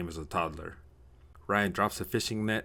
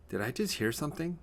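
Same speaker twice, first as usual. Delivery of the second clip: high, questioning inner voice; puzzled thought; 8 semitones higher than usual. Acted. Ryan wakes in a dark room, puzzled. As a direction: wonder aloud, pitched high.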